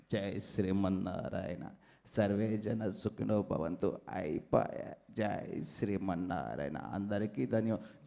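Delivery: very low, soft chanting holy man; holy man impression. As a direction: intone a blessing deep and softly. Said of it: "unique mimicry concept". (లోతైన, మెల్లని స్వామీజీ గొంతు)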